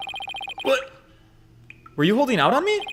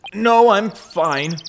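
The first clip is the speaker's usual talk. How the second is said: ordinary talk, nothing marked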